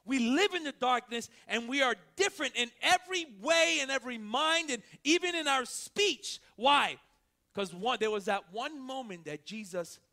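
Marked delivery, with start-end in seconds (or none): 0.1-7.0 full-force preaching. Acted. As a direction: proclaim it to the whole church.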